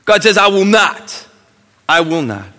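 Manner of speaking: loud, high insistent declaration; full-force preaching. Acted. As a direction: declare God's refusal firmly. Preaching God's promise.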